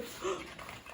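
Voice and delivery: gasp. Acted. Reader reacts to child.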